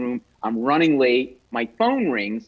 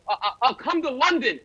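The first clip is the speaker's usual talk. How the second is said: high, nervous laughing stammer; flustered stammer; 10 semitones higher than usual. Retold or imitated. Starstruck comic blurting to [name], high nervous stammer. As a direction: trip over the words in panic. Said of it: "I go".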